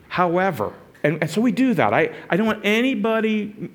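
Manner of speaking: usual speaking voice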